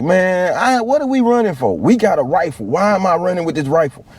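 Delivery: a squeaky, put-upon recruit; high whiny complaint. Retold or imitated. Recruit griping to his buddy while running, high whiny voice.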